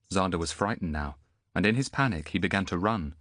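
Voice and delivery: ordinary delivery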